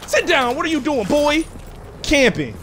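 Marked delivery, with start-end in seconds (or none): 0.1-1.4 much higher mock scolding teacher; angry teacher. Teacher catching the kid with the DS, high mock scolding. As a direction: squeal it like a fed-up teacher. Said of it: "he like".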